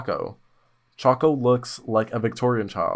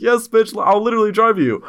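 excited friend; 8 semitones higher, 5 dB louder than usual. Retold or imitated. Friend eagerly offering a ride.

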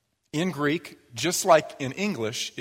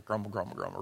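low, much softer grumble grumble; muttered grumbling; 6 semitones lower, 11 dB quieter, faster than usual. Shown as a demonstration. Preacher showing how he grumbles over the dishes. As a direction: grumble under your breath about chores. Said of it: "I'll do this, but".

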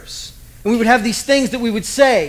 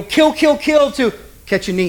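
normal talking voice

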